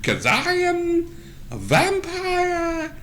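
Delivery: very high, slow squeaky boast; hammed-up weakling playing tough vampire; mock-mighty vampire. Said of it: "and he goes".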